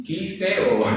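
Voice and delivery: ordinary talk, nothing marked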